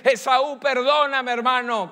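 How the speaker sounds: loud, high cry begging forgiveness; pleading cry. Acted. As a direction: cry out to your brother for mercy. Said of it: "Comenzó a gritar y a exclamar".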